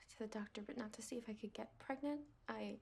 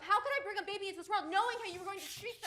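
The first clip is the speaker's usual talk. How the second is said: higher and louder, laughing scornfully; bitter laughing taunt; 8 semitones higher, 6 dB louder, faster than usual. Acted. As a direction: laugh in his face. Wife taunts husband.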